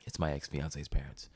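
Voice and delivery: low, hushed confiding; hushed aside. Retold or imitated. Telling his girlfriend at dinner, low hushed voice. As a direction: hush it so the couple nearby can't hear. Whispering to girlfriend about parents.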